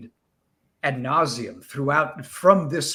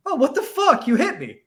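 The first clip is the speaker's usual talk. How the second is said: much higher, louder startled cry with a laugh; shocked surprise; 11 semitones higher, 4 dB louder than usual. Retold or imitated. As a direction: yelp it in mock shock. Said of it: "he's like".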